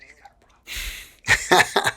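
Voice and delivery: slow hushed insistence. Retold or imitated. Retold insistence.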